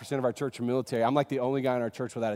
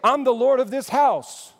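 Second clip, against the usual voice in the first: higher, louder, commanding declaration; bold voice of Jesus; 7 semitones higher, 6 dB louder than usual. Pretend. Pastor voicing Jesus addressing the church, loud and commanding. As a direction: announce yourself as Lord of the house. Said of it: "We want Jesus to start out by going".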